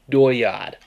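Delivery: Maine "dooryard" as "doy-yahd", R dropped; Maine accent. Shown as a demonstration. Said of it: "Drop the R, add the A H".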